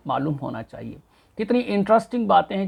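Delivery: ordinary delivery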